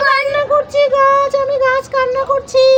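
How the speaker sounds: high mock-weeping tree voice; pretend crying tree. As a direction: play a crying tree for the child, lightly. Mother plays crying tree. (কাঁদুনে গাছের ভান করা, উঁচু গলায়)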